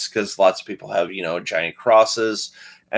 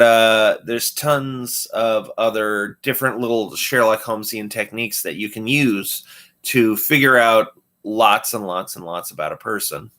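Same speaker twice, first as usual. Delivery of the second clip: normal talking voice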